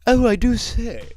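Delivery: high, slow posh British put-on; hammed-up British gentleman exclaiming; mock British. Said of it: "how I imagine how they say eyeballs".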